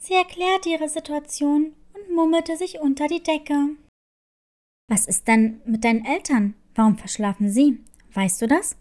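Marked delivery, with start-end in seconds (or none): 4.9-8.8 lower, calm nurse asking gently; school nurse voice. Acted. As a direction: drop low and ask like a kind school nurse. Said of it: "fragte die Ärztin".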